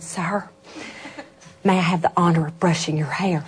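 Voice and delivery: quiet, low, gentle request; soft request. Retold or imitated. Asking the old man in a wheelchair, soft and low. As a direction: ask softly, leaning close.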